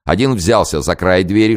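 ordinary delivery

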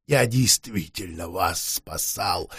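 low, slow, hopeless murmur. Acted. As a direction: say it with no hope left. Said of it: "безнадёжно проговорил вампир".

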